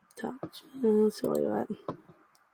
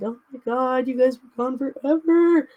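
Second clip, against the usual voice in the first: higher-pitched, excited pretend dog voice; the dog gushing that they were gone forever, voiced higher; voicing the dog; 5 semitones higher than usual. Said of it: "He was like".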